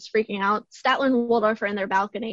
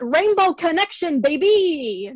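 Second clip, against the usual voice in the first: higher, louder, hyped exclamation; all caps excitement; 6 semitones higher, 5 dB louder, slower than usual. Retold or imitated. Reading her own all-caps notes with hyped excitement. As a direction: shout it like a headline. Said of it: "in my notes, in all caps, in bold".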